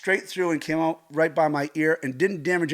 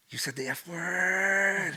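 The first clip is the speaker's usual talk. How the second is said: slow, drawn-out scandalised line; acted childlike amazement, dragged out; mock astonishment; 3 semitones higher, 4 dB quieter, much slower than usual. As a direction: stretch the last word in awe. Mock astonishment at mom swearing.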